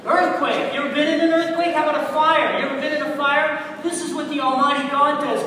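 loud, high, fired-up preaching; forceful preaching. Real, not acted. Preacher on God's displays of power, forceful preaching. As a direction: hammer the questions at the congregation. Preacher erupts back into sermon.

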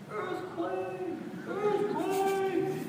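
high, drawn-out scream held back in volume; mock scream. Neighbor screaming from the shower, distant mock scream. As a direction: imitate a distant panicked scream. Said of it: "out in the shower screaming".

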